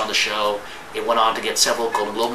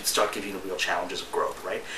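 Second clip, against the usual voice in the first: ordinary talk, nothing marked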